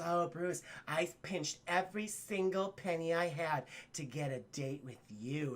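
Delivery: low soft fawning coo; breathy admirer. Acted. Gala ladies fawning over Bruce, soft low coo. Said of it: "ladies rubbing up".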